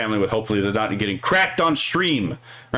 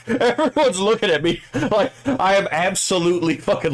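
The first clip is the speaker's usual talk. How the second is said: laughing; 6 semitones higher than usual. Real, not acted. Laughing while retelling funny story.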